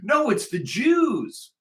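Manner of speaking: high, drawn-out mock wail; mocking imitation. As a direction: parrot them in a high, slow whine. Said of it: "and they'll go".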